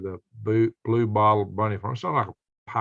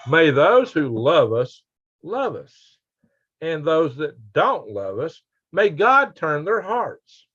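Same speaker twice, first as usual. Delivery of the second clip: mock solemn blessing; 5 semitones higher, slower than usual. Reads a joke blessing from chat.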